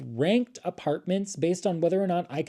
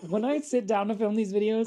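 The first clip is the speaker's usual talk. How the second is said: laughing; 8 semitones higher than usual. Real, not acted.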